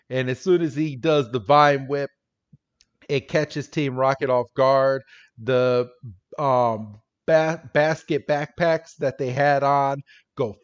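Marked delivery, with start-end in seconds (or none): none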